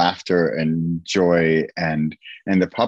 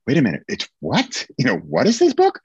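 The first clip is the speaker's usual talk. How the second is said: higher-pitched mock surprise, questioning; intrigued interviewer; 5 semitones higher than usual. Imagined podcast host hearing about the book, puzzled and intrigued. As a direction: act out an interviewer doing a double take. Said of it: "the interviewer on the podcast saying".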